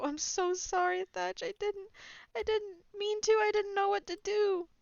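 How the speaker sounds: high, choked-up crying apology; weeping and apologizing; tearful apology. Acted. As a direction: sob it out to an old friend. Captain crying to Thatch.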